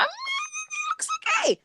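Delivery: very high, soft squeal; high-pitched wavering mock whine; imitating own reaction. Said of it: "I was like".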